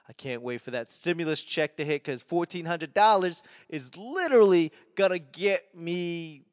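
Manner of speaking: ordinary talk, nothing marked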